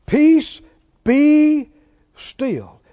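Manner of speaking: loud, punched single words; high, forceful proclamation; commanding. Retold or imitated. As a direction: command the storm, word by word. Quoting Jesus rebuking the sea.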